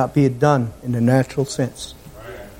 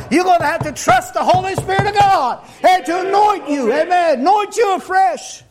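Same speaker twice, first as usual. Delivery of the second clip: high-pitched, driving revival preacher; impassioned pulpit cry; full-force preaching; 12 semitones higher than usual. Real, not acted. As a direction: preach it hard, voice pitched way up, stretching the key phrases.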